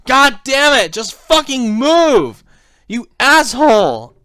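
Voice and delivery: loud, high, drawn-out cursing; yelling at the screen. Retold or imitated. Player yelling at the climbing kid as stamina drains, frustrated yell. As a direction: shout it slowly in exasperation at the game. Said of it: "like, yelling at the screen".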